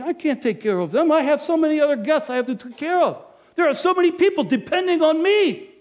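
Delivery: high, loud, pressured outburst; building to a shout of frustration; overwhelmed rant. Acted. Innkeeper at the well rants loudly that everyone depends on him.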